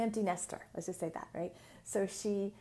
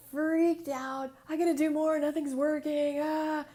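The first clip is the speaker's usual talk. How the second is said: higher, louder frantic panic; panicked; 6 semitones higher, 6 dB louder than usual. Retold or imitated. Client freaking out to her healer, louder and higher in panic. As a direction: rattle it off like she's freaking out.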